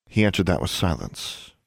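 ordinary delivery

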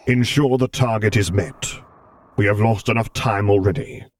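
stern commander. Acted. Vader voiced in story.

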